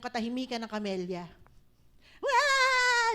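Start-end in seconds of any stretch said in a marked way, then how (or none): 2.2-3.1 put-on loud high scream of rowdy kids; children's wild yell. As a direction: shriek like children going wild. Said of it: "Nagkakagulo yung mga bata". (malakas na sigaw ng mga bata)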